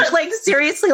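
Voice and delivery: high-pitched, laughing through the words. Real, not acted. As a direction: laugh as you insist. Laughing at own reptilian remark.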